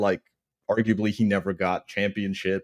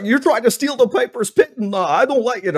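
higher and louder mock Piper rant; 13 semitones higher, 5 dB louder than usual. Piper guarding his show from the newcomer, high and loud. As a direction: complain like a territorial veteran. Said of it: "he's like".

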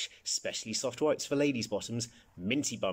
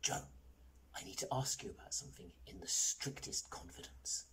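hushed, voiceless whisper; confidential whisper; 12 dB quieter than usual. A narrator's reading. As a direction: whisper a secret request. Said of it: "lowering his voice to a whisper".

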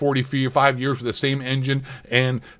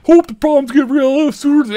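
high, loud sneering quote; mocking voice; 12 semitones higher, 7 dB louder than usual. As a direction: sneer the line back. Mocking article line.

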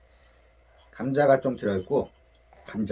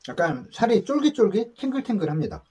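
words slurred by food; speaking mid-bite; talking while chewing; 6 semitones higher than usual. Real, not acted. Seen 2: Man bit food at -3, talks with full cheeks after.

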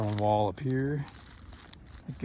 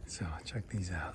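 whisper; 4 semitones lower, 9 dB quieter than usual. Real, not acted. Whispered aside on the trail.

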